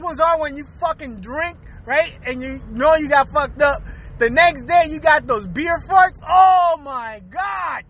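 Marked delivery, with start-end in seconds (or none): 6.3-7.8 comic exclamation of disgust. Acted. Podcaster recalling horrendous beer farts, comic disgust. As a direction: wail it in mock horror.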